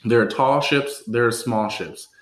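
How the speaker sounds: slow, measured toast recital; careful retry. Shown as a demonstration. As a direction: recite the tongue twister deliberately, phrase by phrase. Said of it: "let's try this one more time".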